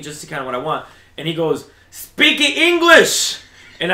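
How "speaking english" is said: high, loud, slow mock yell; old cashier's slow, high, loud jab. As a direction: stretch it out loud like a rude old clerk. Old cashier mocks Mexican customer.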